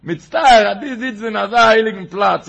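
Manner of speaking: loud, high outburst of retold rebuke; forceful mock-indignant cry; acted exclamation.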